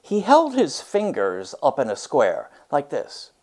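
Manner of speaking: lively read-aloud storytelling voice; story narration. A narrator's reading. Teacher reads a picture book to her class, expressive read-aloud.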